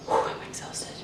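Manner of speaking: breathless whisper after non-stop chores; out of breath. Real, not acted. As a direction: puff it out, totally worn out. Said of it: "I'm literally out of breath".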